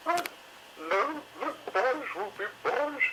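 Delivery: high fretting lady dinosaur; fussy Missus Carey. A narrator's reading. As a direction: complain like a put-upon mother dinosaur.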